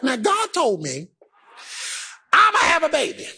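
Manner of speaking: ordinary talk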